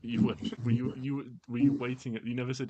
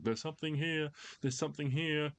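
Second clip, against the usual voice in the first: mock muffled guard shout; 9 semitones higher than usual. Game master voicing the guard's alarm, restrained high shout. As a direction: lightly act a guard's distant shout. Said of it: "the muffled sound of the guard shouting".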